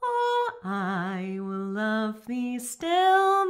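ordinary talk

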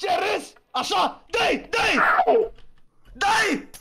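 high-pitched urgent battle calls; excited egging-on. Real, not acted. Taunting, then urging attack.

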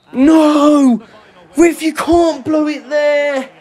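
high, loud and slow despairing yell; real furious cry; anguished shout. Real, not acted. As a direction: plead with the player too late. Player misses a sitter.

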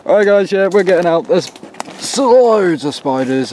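loud, high and rattled; hurried alarmed exclamation. Real, not acted. Fleeing spiders in hut.

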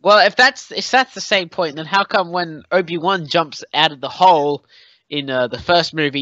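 normal talking voice

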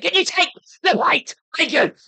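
high, loud exasperated yell; strained, stressed-out outburst; stressed shout. Real, not acted. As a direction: yell the demand in frustration. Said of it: "I get so stressed".

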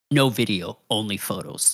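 a plain guy voice out of character; low, quiet no video; normal speaking voice. Retold or imitated. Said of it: "changed his voice immediately to, like, his normal speaking voice".

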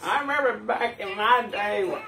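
high-pitched imitation of an old codger; old cartoon dude. Viewer recalling Paradise PD's old dude, high old-man impression. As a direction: pitch it way up like a reminiscing old-timer. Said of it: "the old dude that was always talking about".

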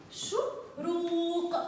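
شروق drawn out very slowly, every sound held. Shown as a demonstration. Teacher sounding out a word slowly for pupils. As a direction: stretch the word to find the final qaf. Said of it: "أنطق بها بصوت القاف".

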